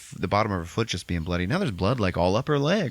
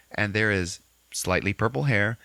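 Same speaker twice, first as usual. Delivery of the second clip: ordinary talk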